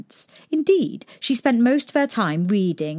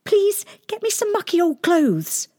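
urgent princess; 12 semitones higher than usual. A narrator's reading. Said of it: "spoke urgently with her new friend".

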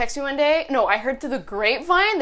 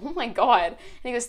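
usual speaking voice

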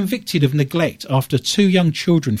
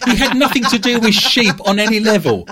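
laughing; 7 semitones higher, 3 dB louder than usual. Real, not acted. Laughing protest about Jesus.

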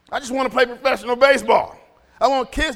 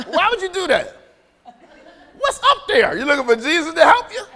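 high, laughing teasing of his son; laughing mock scolding of his son; mock talking to son; 5 semitones higher than usual. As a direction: tease the boy while laughing. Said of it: "he does this".

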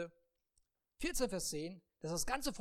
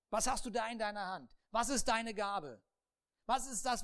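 usual speaking voice

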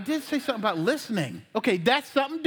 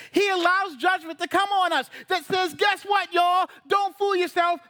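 high, driving sermon delivery; forceful preaching; 7 semitones higher, 4 dB louder than usual. Real, not acted. Preacher warning the congregation about judgment of sin, forceful preaching. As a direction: press each phrase home with rising urgency.

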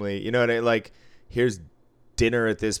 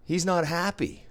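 high mocking drunk taunt; drunk gambler voice; 10 semitones higher than usual. Drunk player taunting the unsmiling dealer, high mock voice. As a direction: needle the dealer in a much higher put-on voice. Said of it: "He goes".

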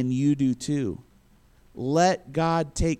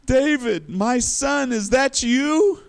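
high, drawn-out tearful call; mock tearful cry; 8 semitones higher, slower than usual. Saul breaks down calling to David, mock crying. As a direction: act breaking down, pitch raised and words stretched. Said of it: "he breaks down and crying".

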